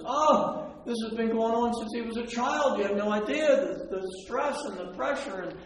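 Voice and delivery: high, loud, frantic outpouring; desperate father. Retold or imitated. Father begging Jesus for his son, high and frantic. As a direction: pour out years of fear in a rush. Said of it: "the father just freaks out that moment".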